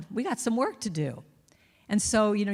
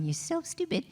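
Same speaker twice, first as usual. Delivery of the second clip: nagging inner voice; 8 semitones higher, 3 dB quieter than usual. Retold or imitated.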